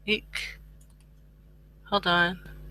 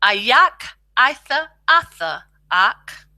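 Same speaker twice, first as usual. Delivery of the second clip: slow demo; 3 semitones higher than usual. Shown as a demonstration. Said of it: "good to practice out".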